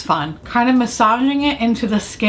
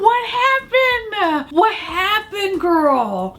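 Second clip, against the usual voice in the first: mock cry; 9 semitones higher, much slower than usual. Horrified at makeup result.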